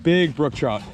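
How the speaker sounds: high excited chant; high, thrilled repeated chant. Real, not acted. Excited as fish nears hole.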